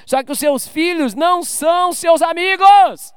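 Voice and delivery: slow, shouted warning; forceful preaching. Real, not acted. Preacher warning parents, slow and loud. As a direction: drive the point home loudly. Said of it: "preaching at full force".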